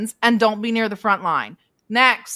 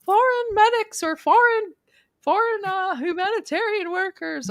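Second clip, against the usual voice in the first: high-pitched mock excuse; mocking imitation; 10 semitones higher than usual. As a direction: parrot their excuse in a high, silly voice. Mocking their repeated excuse.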